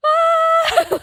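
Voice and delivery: short very high mock shriek of panic; a high flustered squeal. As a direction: yelp it high like sudden alarm. Panicked at empty aisle.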